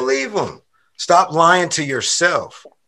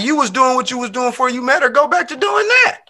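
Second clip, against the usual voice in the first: high-pitched, exasperated-sounding protest; animated protest; 7 semitones higher than usual. Acted. Man scolding someone over changing after meeting her, animated protest. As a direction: push it up high, dragging out the end. Animated reaction to imagined partner.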